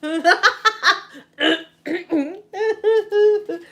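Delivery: loud, high re-enacted cackle; uncontrollable laughing fit. Granddaughter cracks up at granny's funeral, uncontrollable mock laughing fit. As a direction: burst out in helpless, imitated laughter. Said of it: "like that, right? Laughing and stuff".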